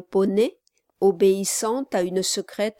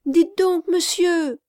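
high, loud call right at his ear; a girl shouting to be heard from behind; breathless servant girl; 9 semitones higher, 5 dB louder than usual. Acted. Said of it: "jeta tout près de son oreille ces mots".

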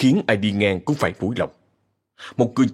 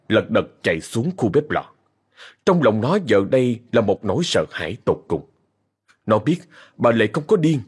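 usual speaking voice

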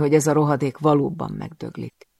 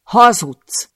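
loud, high, furious yell; 8 semitones higher, 12 dB louder than usual. Acted. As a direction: scream the accusation. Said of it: "ordítom". (ordítva, dühösen)

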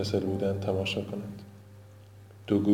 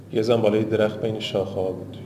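usual speaking voice

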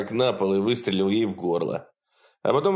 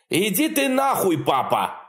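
Clyde yells; 10 semitones higher than usual. Acted. Clyde screams at his father before shooting him. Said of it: "заорал Клайд".

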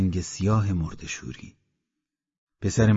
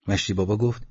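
ordinary delivery

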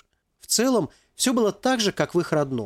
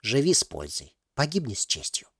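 put-on old woman whispering; 2 semitones lower, 4 dB quieter than usual. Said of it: "прошептала бабушка".

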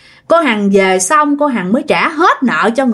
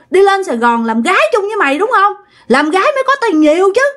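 raised pitch, mounting to high, fast accusations; angry accusation; 5 semitones higher than usual. Acted. Husband blames neighbour for luring his wife away, angrily accusing. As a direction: confront her with growing indignation. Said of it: "ổng mới chửi".

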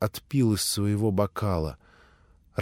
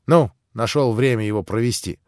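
ordinary delivery, nothing marked

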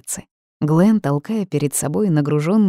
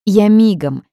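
louder, higher happy call; glad shout; 5 semitones higher, 7 dB louder, slower than usual. Acted. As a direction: shout it out, delighted to help. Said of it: "обрадованно крикнул Глен".

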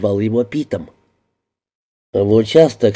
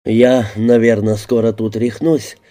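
ordinary delivery, nothing marked

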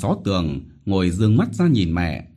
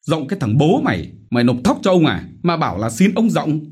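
higher, louder scornful yell; mock her plea with rage; snarling rebuke; 8 semitones higher, 4 dB louder, faster than usual. Chief scorning Vít's plea, angry yell. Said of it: "Hắn quát".

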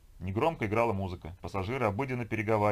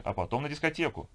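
lightly voiced woman, pitched higher; upbeat announcement; 7 semitones higher than usual. Companion nudging a sleepy man towards the disco, upbeat. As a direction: chirp the plan brightly, voice lifted.

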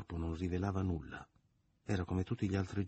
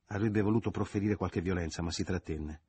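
ordinary delivery

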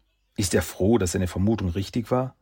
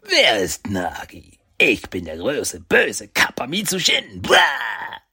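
slow, playful growling kappa voice; guttural monster grunt; 7 semitones higher, 6 dB louder, slower than usual. Pretend. Big brother plays a kappa monster to tease Saki, guttural. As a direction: play the big bad monster to scare him. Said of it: "ein gutturales Grunzen".

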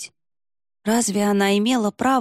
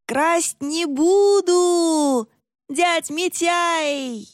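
high, very drawn-out holler; calling from afar; 11 semitones higher, 4 dB louder, much slower than usual. A narrator's reading. Creature shouting back from the yard, calling out. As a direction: stretch each word out, calling back from a distance. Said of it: "донеслось со двора".

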